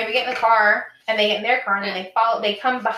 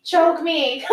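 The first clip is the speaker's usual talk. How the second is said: mock sultry request; 8 semitones higher, 4 dB louder than usual. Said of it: "and we're like".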